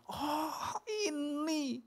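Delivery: high, crying "Oh, ini"; choked-up cry. Real, not acted. Preacher overcome while reading to the congregation, crying. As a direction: let the voice break with emotion.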